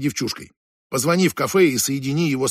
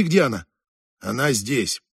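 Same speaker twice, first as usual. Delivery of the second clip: ordinary delivery, nothing marked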